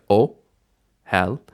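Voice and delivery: ohel broken in two with a clear pause; split syllables. Shown as a demonstration. Teacher counting two syllables for learners, split syllables in ohel. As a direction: say ohel one syllable at a time. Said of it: "And so bisyllabic".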